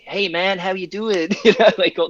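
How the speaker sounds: mock-cheery American greeting; terrible American accent. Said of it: "that's my terrible American accent".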